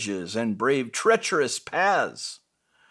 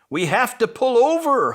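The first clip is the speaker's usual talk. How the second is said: higher, louder holler over the wind; 8 semitones higher, 4 dB louder than usual. Acted. Truck yelling to Little Red over whipping wind, hollering. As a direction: call it out through a snowstorm. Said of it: "hollered Little Truck".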